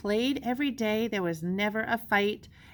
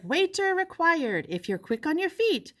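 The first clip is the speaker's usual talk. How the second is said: ad voice; 8 semitones higher than usual. Acted. Ad read in announcer voice.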